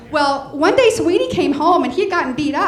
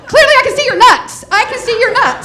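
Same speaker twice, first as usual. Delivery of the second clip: high, loud, laughing punchline with nuts; laughing out the right word nuts; corrected punchline laughing; 9 semitones higher, 8 dB louder than usual. Real, not acted. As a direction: nail the fixed line while still cracking up. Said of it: "Wait, wait, no".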